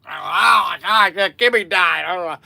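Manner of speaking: loud mock-drunk lament; imitated boozy grieving, loud; half-cocked drunk. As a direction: wail like a maudlin drunk. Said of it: "I'm half, I'm half cocked".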